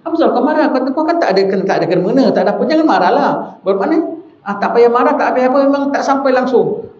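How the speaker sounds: mocking taunt. Friend taunting angry opponent.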